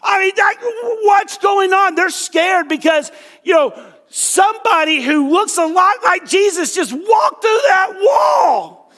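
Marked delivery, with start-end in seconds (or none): 0.0-1.9 high, hammed-up panicked shout; acted frightened outburst; freaking out. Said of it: "because they're freaking out. They're like". 4.1-8.9 high, drawn-out astonished shout; acted panic, voice pitched way up; shocked alarm. Voicing the disciples' shock, loudly.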